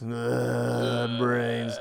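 lightly voiced zombie moan; a hint of a groaning zombie. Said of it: "give me a zombie".

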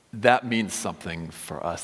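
usual speaking voice

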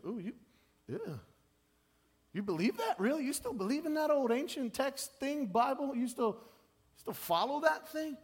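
high, sneering voice of a doubter; mocking scoffer. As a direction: scoff at the believer as if the Bible were a joke. Mocking critics of Bible belief.

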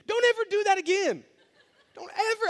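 high, loud playful scolding through laughter; laughing scold. Retold or imitated. Pastor warns his friend, laughing, never to send that again. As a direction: scold him while cracking up.

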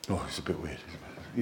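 dropped low and soft, like gossip behind a hand; mock aside. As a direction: murmur it as others would about him. Others judging the preacher.

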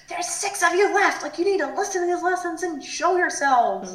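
mock angry telling-off, pitched high; Ricky. Ricky scolds the remaining contestants, mock mad. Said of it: "Ricky gets mad".

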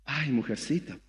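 soft, low mock sigh "Ay, mujercita"; mocking pitiful whimper; whiny self-pity. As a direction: sigh it out like a wounded victim. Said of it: "¿Y qué eres?".

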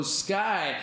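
ordinary delivery

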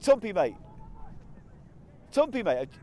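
Cockney. Acted. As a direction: call out like a Cockney geezer.

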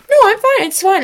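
mock-panicked self-reassurance; high-pitched denial. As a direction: insist you're fine in a high voice. Acting out denial of injury.

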